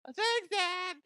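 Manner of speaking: put-on kid voice. Kid thanking dad for fetching food, high chirpy.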